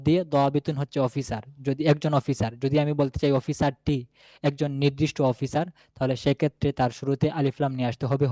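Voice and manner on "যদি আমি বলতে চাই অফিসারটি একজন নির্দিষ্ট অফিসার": ordinary talk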